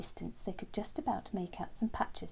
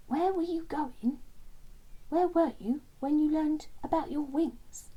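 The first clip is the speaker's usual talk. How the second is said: higher, louder questioning voice; insistent, encouraging prompt, pitched up; Len prompting; 8 semitones higher, 4 dB louder than usual. Acted. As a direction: coax the memory out with each question. Len prompts Terence's memory.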